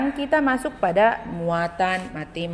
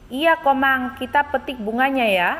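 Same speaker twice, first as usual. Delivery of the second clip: lightly voiced child Lani, higher and eager; voicing Lani lightly; 6 semitones higher than usual. Teacher reads Lani's line to the class, lightly higher and eager. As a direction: read it like a cheerful little girl, pitched up. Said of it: "ada percakapan antara Lani dan Komang".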